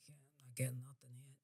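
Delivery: hushed whisper to herself; whispered aside. Retold or imitated. Psychic admitting to herself she reads nothing, whispered. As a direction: breathe it out as a private thought. Psychic retelling her inner reaction.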